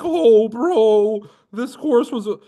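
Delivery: high, loud whiny bro; whiny burned buyer.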